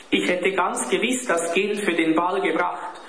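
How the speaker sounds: put-on high, pleading boy's voice; narrated despair, pitched high; desperate sobbing. A narrator's reading. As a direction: protest like a child close to tears. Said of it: "schluchzte Klaus verzweifelt".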